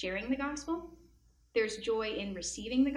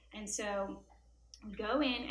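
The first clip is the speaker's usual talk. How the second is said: ordinary delivery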